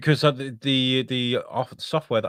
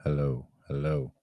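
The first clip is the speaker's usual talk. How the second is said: deep voice effect; 10 semitones lower, 5 dB quieter, slower than usual. Acted. Trying voice changer effects.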